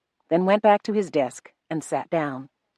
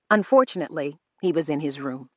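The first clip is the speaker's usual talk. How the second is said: ordinary delivery, nothing marked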